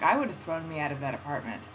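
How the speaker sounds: ordinary delivery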